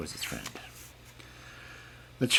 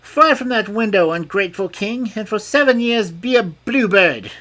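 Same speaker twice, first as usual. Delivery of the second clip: much higher, louder curse; spell casting fairy; 12 semitones higher, 8 dB louder than usual. A narrator's reading. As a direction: pronounce the spell.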